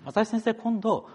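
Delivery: high-pitched imitation of a parishioner's request; church member's request. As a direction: voice a church member asking the pastor. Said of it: "って言ってきてくださったんです".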